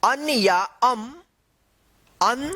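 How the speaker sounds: teacher chanting vowels one by one; slow classroom vowel recital; reciting vowels. Shown as a demonstration. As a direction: call out each vowel clearly for the class. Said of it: "एक बार और repeat कर देते हैं".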